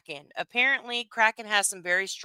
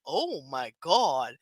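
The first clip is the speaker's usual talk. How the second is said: stunned reaction; 6 semitones lower, slower than usual. Retold or imitated. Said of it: "and I was like".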